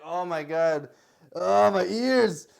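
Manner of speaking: mock-horrified groan, loud and drawn out; pained listener. Audience reacting to nasal karaoke singing, pained and loud. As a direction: act out an audience wincing at bad singing. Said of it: "it just sounds like".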